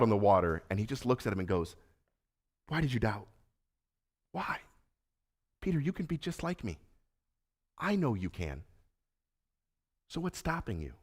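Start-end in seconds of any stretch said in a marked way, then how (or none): 2.7-10.9 quiet, tender voice of Jesus; Jesus softly asking Peter why he doubted, hushed and tender. Acted.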